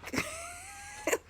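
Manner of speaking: shrieking high laugh; high squeal laugh. Real, not acted. To her viewers, a high squealing laugh at herself. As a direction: let a squealing laugh burst out.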